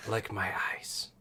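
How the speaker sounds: slow whispered snake lady; hushed serpent woman; snake mom voice. Acted. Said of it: "Snake Mom's voice".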